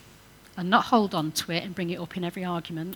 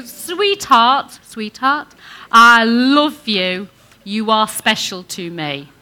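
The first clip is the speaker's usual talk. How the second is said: louder, slowed, drawn-out "I love you"; loving declaration; 11 dB louder, much slower than usual. Shown as a demonstration. Preacher leading men to say it, slow and loving. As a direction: lead the congregation in a loving line. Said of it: "Can we practice that".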